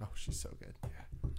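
whispering. Real, not acted. Whispered aside.

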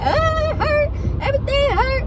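high, whining child voice in mock pain; dramatic whiny kid. Mimicking a kid patient whining about pain. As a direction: ham up a hurting kid's complaint. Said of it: "over the top dramatic".

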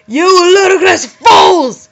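high, loud, slow furious yell; angry shout. Acted. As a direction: bellow it like an enraged headmaster. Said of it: "He kept shouting at us angrily".

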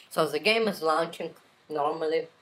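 ordinary talk, nothing marked

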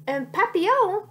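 high, loud, disbelieving question; exclaiming. A narrator's reading. Sacha told it is a butterfly, exclaiming. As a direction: exclaim it in surprise, pitched right up.

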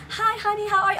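high-voiced mock of the star saying hello; Suki's greeting. Celebrity greeting a fan at her party, high imitation. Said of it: "She's like".

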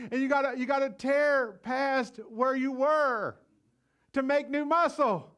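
slow, loud, emphatic preaching; preaching shout. Real, not acted. Preacher urging church to push past limits, loud and slow. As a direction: drive it home to the congregation.

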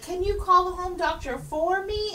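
high-pitched mock pleading; pleading caller. Dying caller asks the operator for help, mock pleading. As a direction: voice a helpless caller, pitched right up.

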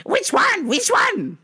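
high, insistent shopper voice; mock a demanding customer; impatient customer.

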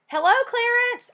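high, bright call up to the crow; shouted greeting. A narrator's reading. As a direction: shout hello up to him. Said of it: "I had shouted at him".

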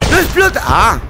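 high, loud startled yell; surprised shout. Real, not acted. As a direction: shout out in shock and relief. Grenade fails to go off.

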